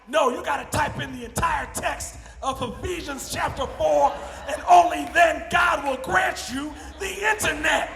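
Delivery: slow, loud, rising grandma sermon; preaching grandma. Retold or imitated. Grandma insisting on scripture for the internet, loud slow preaching. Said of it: "she's like".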